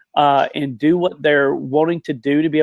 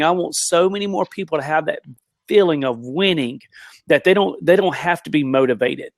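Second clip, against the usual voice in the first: ordinary talk, nothing marked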